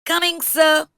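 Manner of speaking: high, loud call back; eager shout. A narrator's reading. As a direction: shout it back across the water. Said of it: "Adityan shouted".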